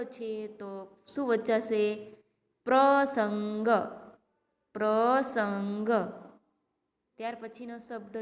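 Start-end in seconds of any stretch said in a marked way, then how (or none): 2.7-5.9 પ્રસંગ read very slowly, syllable by syllable; slow teacher reading; slow model reading. Shown as a demonstration. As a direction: draw the word out for pupils to copy. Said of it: "જોઈજો, હું વાંચું છું".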